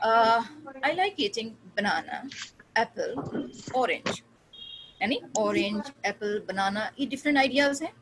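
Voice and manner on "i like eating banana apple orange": banana, apple, orange, each set off by a pause; slow list with a comma pause after banana and apple; pauses between list items. Shown as a demonstration. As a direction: read the list with a short pause before each item. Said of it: "मैं इसके बीच में pauses use कर रही हूँ".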